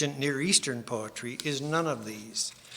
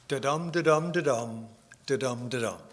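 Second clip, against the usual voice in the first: da-dum chanted with the stress on each dum; iambic rhythm. Shown as a demonstration. Demonstrating iambic pentameter to the audience in rhythmic da-dum. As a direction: tap out the iambic beat, weak-STRONG, da-DUM. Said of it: "five of those iambic feet in a row".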